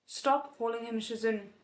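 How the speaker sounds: ordinary delivery, nothing marked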